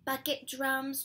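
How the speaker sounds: plain reading voice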